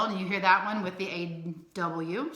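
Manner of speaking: ordinary talk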